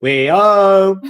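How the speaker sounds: loud shout of we're home; shouted announcement. Retold or imitated. Arriving home, shouting to the neighbours loudly. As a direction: bellow it through the house. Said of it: "We tell 'em".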